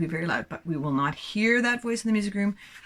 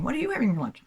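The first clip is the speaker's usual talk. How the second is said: higher-pitched chatty meerkat question; lightly voiced meerkat; 7 semitones higher, much faster than usual. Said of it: "they look like they're saying".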